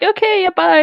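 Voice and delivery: imitating everyone's goodbye; mock group goodbye. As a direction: chirp the goodbye. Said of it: "We were all like".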